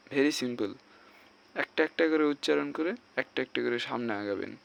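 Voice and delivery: normal talking voice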